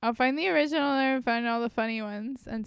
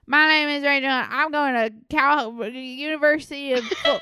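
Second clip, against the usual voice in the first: imitating a Southern college girl; Southern accent; 3 semitones higher, 4 dB louder than usual. Friend mocks TikTok girls in a Southern accent. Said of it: "really Southern accents".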